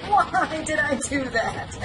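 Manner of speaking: giggling as the clumsy hero; laughing regret. Retold or imitated. Player voicing Ezio after his fall, laughing. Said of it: "He's like".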